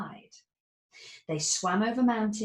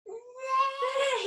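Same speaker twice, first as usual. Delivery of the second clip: shout. A narrator's reading. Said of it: "he shouted".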